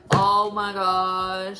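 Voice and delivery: slow, loud drawn-out relief; relieved gasp. Real, not acted. New mum seeing her baby arrive, slow and relieved. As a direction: let it out slowly like a huge release.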